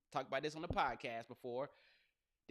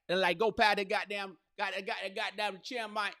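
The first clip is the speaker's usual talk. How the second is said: louder, higher Atlanta Southern accent, stumbling and repeating; 4 semitones higher, 6 dB louder than usual. Shown as a demonstration. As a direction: coach a punch in a hammed-up down south Atlanta accent. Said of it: "I could do the accent".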